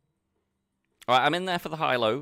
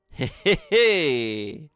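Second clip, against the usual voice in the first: excited shout; 3 semitones higher, 8 dB louder, much slower than usual. Real, not acted. Winning the bounty hand.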